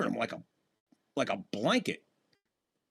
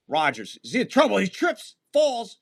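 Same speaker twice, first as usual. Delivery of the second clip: rising higher and louder as he falls; excited play-by-play; 6 semitones higher, 4 dB louder than usual. Real, not acted. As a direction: call the stumble with building alarm. Live play-by-play, QB falling.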